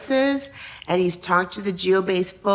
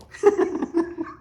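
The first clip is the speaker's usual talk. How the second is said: laughing; 12 semitones higher, 3 dB louder than usual. Real, not acted. Boss said no, she laughs.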